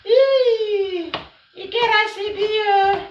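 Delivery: high delighted exclamation; excited cry. Real, not acted. Bursts out over the news.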